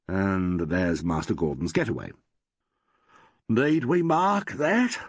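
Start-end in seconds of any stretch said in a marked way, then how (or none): 3.5-5.0 slow question stretched by a big yawn. A narrator's reading. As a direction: drag it out through a tired yawn. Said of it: "yawning horribly".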